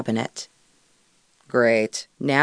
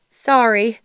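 flustered; 8 semitones higher, 7 dB louder than usual. A narrator's reading. Embarrassed apology, caught wet.

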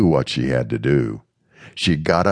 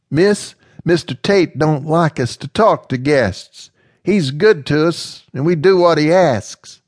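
higher, louder character voice; wary and respectful; young slave woman, cautious; 12 semitones higher, 4 dB louder than usual. Acted. Slave woman explaining the rules to Amanda, cautious.

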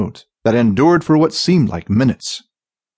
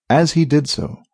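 ordinary delivery, nothing marked

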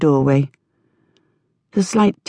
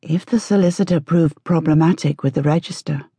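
usual speaking voice

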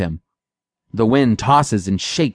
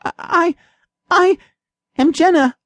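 timid stammer. A narrator's reading. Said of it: "Timid and terrified".

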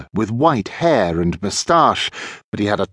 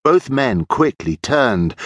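usual speaking voice

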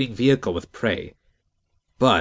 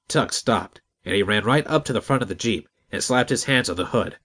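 ordinary delivery

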